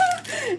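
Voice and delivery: short tipsy giggle; quick high laugh. Real, not acted. Laughs at own joke.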